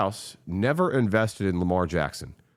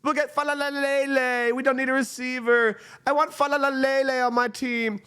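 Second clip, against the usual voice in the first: mocking front office; 14 semitones higher than usual. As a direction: ham up a clueless team boss picking players. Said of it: "and we just said".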